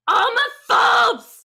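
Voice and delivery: high, long-drawn accusing shout; yelling. Acted. Horned lady yelling back from the street, accusing shout. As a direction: holler it slowly at full volume. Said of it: "And she yells".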